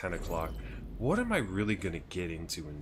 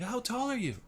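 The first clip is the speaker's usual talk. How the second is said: much higher, put-on admiring voice; imitating Japanese woman. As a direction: mimic her keen question up high. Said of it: "she's like".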